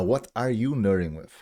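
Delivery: ordinary talk, nothing marked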